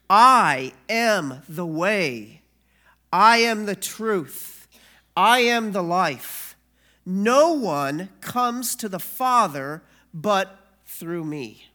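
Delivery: slow, loud, weighty proclamation of each line; solemn scripture reading. Acted. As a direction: pastor proclaiming Jesus' words to the congregation, slow and loud. Reads scripture with authority.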